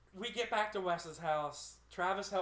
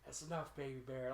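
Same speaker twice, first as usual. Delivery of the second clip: imitated drunk soothing; 5 semitones lower, 8 dB quieter than usual. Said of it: "I started turning into Wes".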